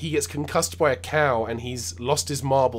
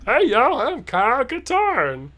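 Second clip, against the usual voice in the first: loud, high, drawn-out American caricature; mock American accent; 11 semitones higher, 6 dB louder than usual. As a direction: lay the fake American accent on thick. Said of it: "that was, uh, [name]'s one hundred percent accurate, uh, American accent".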